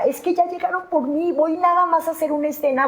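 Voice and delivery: súplica angustiada. Retold or imitated. Suplica que la dejen ir.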